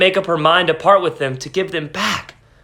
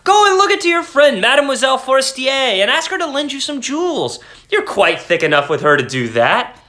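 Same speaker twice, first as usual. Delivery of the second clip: cried out; 10 semitones higher than usual. A narrator's reading. Husband crying out his idea to wife, excited. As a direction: burst out with the bright idea. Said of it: "her husband cried".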